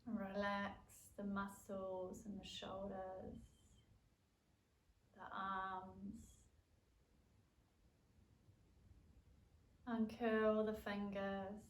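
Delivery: calm yoga nidra guidance with long pauses; guided relaxation. Acted. Leads a yoga nidra practice.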